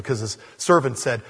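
usual speaking voice

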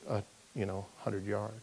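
usual speaking voice

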